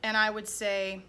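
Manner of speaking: usual speaking voice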